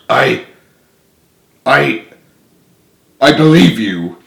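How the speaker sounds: stammered, hesitant belief; halting admission. Acted. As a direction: force out each I, then give in.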